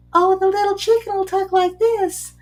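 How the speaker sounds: high storytime voice for a little chicken. Shown as a demonstration. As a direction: squeak it out like a tiny chicken. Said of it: "change the intonation if I'm talking about certain characters".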